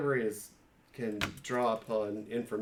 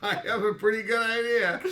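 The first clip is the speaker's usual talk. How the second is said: higher and louder, amused; laughing; 12 semitones higher, 10 dB louder than usual. Real, not acted. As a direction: grin through the line. Player laughing reply.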